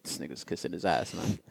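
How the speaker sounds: sneering aside about a suck-up, under the breath; muttered aside. Retold or imitated. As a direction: mutter it so the coworker can't hear.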